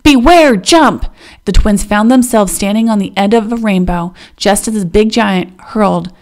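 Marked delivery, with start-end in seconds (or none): 0.0-1.1 loud urgent warning call. Acted. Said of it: "Nilchi the Wind called".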